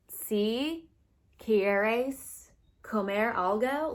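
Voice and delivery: put-on slow, clumsy Spanish in a thick American accent; mock a gringo sounding out each Spanish word; drawn-out textbook Spanish in an American accent. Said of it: "but they do it in, like, an American accent".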